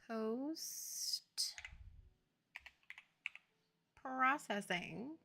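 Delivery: hushed, stretched-out words with a long gap; softly drawn out, distracted; absent-minded searching. Real, not acted. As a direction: mutter it slowly while hunting for something. Reading out while searching menu.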